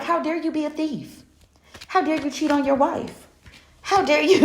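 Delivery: hammed-up how-dare-you clapback; sassy comeback. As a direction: throw their judgment right back at them. Sassy comeback to judging people.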